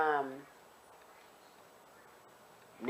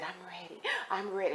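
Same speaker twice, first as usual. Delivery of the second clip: quiet tearful retelling; 9 dB quieter than usual. Retold or imitated. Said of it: "And she crying".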